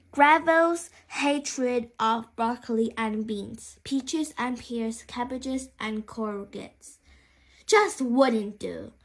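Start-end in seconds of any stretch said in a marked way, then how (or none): none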